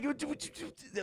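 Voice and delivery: flustered mock stammer, lightly done. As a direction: stumble over the first sound, caught off guard. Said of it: "And I'm like".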